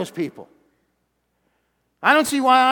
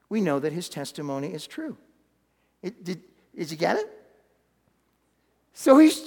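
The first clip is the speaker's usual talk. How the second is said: normal talking voice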